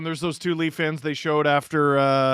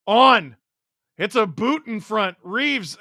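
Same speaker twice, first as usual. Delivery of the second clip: high, urgent call of a scoring chance; raised, excited hockey call; excited play-by-play; 6 semitones higher, 4 dB louder, slower than usual. Real, not acted. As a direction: call the chance as it happens, pitched up. Calls a live scoring chance.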